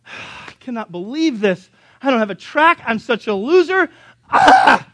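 frustrated outburst, ending in a cry. Retold or imitated. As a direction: vent your frustration out loud. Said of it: "I literally was so frustrated".

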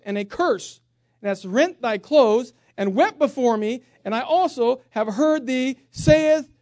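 slow, loud preacher's reading; proclaiming King James scripture to the church. Acted. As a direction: read the verse out slowly with weight. Pastor reads scripture aloud.

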